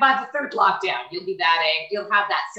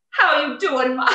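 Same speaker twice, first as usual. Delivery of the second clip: put-on a light Jamaican accent, higher; 7 semitones higher, 3 dB louder than usual. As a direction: greet her in a playful Jamaican accent. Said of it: "with Jamaican Karens".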